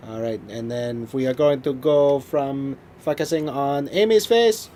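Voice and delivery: high, loud mock French Canadian director; drawn-out French Canadian accent, hammed up; thick French Canadian accent. Director talking his actor through a shot, hammed-up French Canadian accent. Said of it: "he had a really thick accent".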